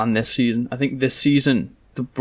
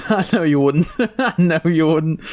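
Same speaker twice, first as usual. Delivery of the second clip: laughing; 3 semitones higher than usual. Real, not acted. Teasing friend, laughing at him.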